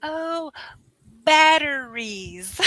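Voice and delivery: "batteries" drawn out slowly in three syllables; dawning realization. Retold or imitated. Teacher retelling the moment she understood, slow dawning realization. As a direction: finally get it, stretching "batteries" out. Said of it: "I'm like, "Oh".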